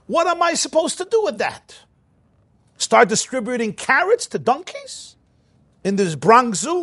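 indignant outburst. Retold or imitated. Rabbi voicing the congresswoman's complaint to the Rebbe, exasperated and high. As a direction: pitch shoots up high in exasperated disbelief.

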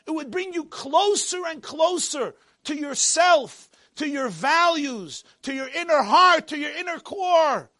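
high, loud, impassioned proclamation; full-force preaching. Acted. God rebuking Yaakov for false worship, shouted preaching. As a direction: thunder it out to the whole congregation.

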